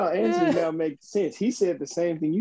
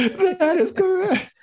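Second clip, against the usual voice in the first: high squeaky voice; 13 semitones higher than usual. Acted. Mimicking host catchphrase.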